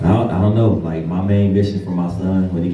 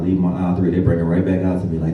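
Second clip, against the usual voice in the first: ordinary talk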